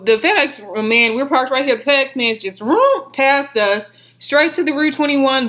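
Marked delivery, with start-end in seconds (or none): 2.6-3.1 mock engine vroom. Imitating the FedEx truck.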